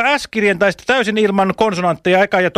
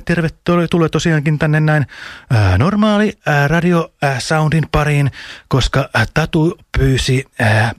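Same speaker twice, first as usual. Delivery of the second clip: deep, smooth radio host voice, dropping lower and lower; radio announcer voice; 8 semitones lower than usual. Shown as a demonstration. Radio host welcoming listeners in a deep radio voice. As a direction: sink into a lower and lower late-night radio voice. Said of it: "tällaisella radioäänellä".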